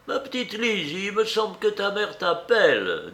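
put-on high-pitched old lady's voice; pointed aunt, pitched well up. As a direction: call the girl in a high elderly voice.

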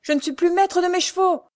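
high, loud shouted order; coachman's yell. A narrator's reading. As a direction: roar at her to step aside. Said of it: "hurla l'automédon". (cocher qui hurle un ordre, voix haute et forte)

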